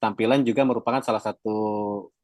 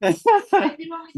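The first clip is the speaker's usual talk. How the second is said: short high real laugh; 4 dB louder than usual. Real, not acted. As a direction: bursts out laughing. Speaker laughs at own joke.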